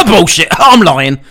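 loud coughed-out retraction; coughed outburst. Pretend. Fake Tourette's cough outburst.

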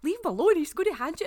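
exasperated telling-off, pitched high and loud; retold loud, high protest; mock scolding. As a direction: scold a friend in high, urgent disbelief. Acted telling-off of friend.

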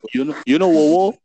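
sing-song Singlish joke; mock Singlish. Teasing a friend with Singlish car names, playful accent.

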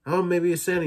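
put-on high, eager child's voice; excited kid. Charlie excited, waking his dad.

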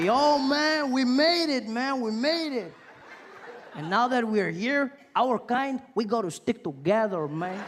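an excited fellow traveller bonding with him; Puerto Rican guy. Retold or imitated. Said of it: "He's like".

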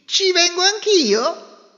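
high, slow duck voice; pleading quacky duck. Acted. Duck asks to come.

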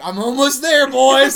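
high, loud rallying cry; hammed-up excited holler; mock triumphant yell. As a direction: shout it as if nearly free. Retold excitement at nearly escaping.

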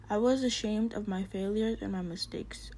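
ordinary delivery

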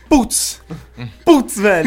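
shouting in panic; panicked exclamation. Retold or imitated. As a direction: blurt it out in alarm. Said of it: "E falei". (exclamação em pânico)

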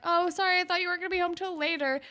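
high, fast breezy apology; high quick ditzy excuse; mock airy excuse. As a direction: rattle off a hammed-up fake apology. Manny voiced, caught out.

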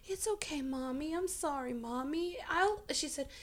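put-on a toddler gently soothing her mum, high and hushed; small child comforting. Voicing three-year-old daughter comforting.

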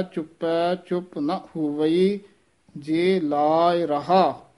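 slow recitation with a clear pause before ਜੇ ਲਾਇ ਰਹਾ. Shown as a demonstration. Teacher shows students the pause in a Gurbani line, slow. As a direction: stop briefly, then say ਜੇ ਲਾਇ ਰਹਾ slowly. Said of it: "ਇੱਥੇ ਠਮਕ ਲਾ ਦੇਣੀ ਹੈ".

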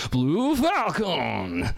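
slow, stretched-out heroic shout; Captain Falcon final smash yell. Retold or imitated. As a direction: belt it like a game announcer. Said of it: "they recorded him going like".

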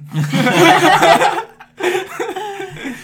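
high drawn-out laugh; a long happy laugh. Real, not acted.

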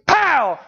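loud high pow of a gunshot; mock gunshot. Preacher acting out the folding chair's bang, loud mock pop. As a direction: shout the bang like a gun going off.

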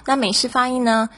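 normal talking voice

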